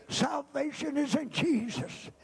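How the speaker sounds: ordinary talk, nothing marked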